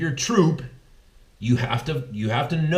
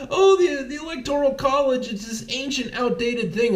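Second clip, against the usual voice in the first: high mocking whine; 11 semitones higher than usual. Podcaster mocks election losers in a high whiny sneer. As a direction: pitch jumps way up into a whiny sneer. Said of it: "these people are like".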